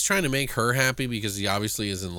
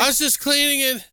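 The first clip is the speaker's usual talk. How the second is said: mock grandpa; 3 dB louder than usual. Echoing the quote as grandpa.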